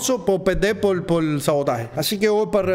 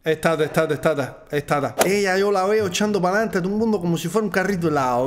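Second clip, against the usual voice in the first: relaxed put-on Cuban accent. Player joking about the CDR in a Cuban accent. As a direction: chat to the group like a Cuban neighbour.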